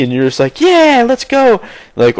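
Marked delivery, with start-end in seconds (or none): 0.6-1.6 high, slow, dopey eager voice; gullible noob. Retold or imitated. A gullible noob agreeing to follow a scammer, high and eager. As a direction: play the naive sucker, high and drawn out. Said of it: "the gullible asshole that's like".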